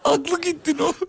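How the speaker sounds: laughing. Real, not acted. Laughing at the other.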